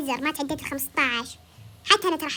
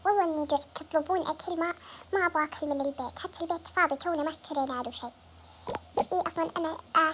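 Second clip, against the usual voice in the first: ordinary talk, nothing marked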